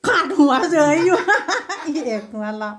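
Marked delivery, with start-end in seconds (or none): 0.1-1.9 loud, higher voice breaking into laughter; real amused outburst; laughing. Real, not acted. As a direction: crack up as you say it. Joking about a cut-off head.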